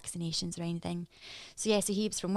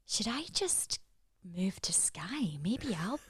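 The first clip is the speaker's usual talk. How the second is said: softly voiced private wondering, slowing on "move to Skye"; inner musing; 7 dB quieter, slower than usual. Host voices a small part of herself tempted by Skye, softly musing. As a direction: muse softly, as if to yourself. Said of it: "there's a small part of me is going".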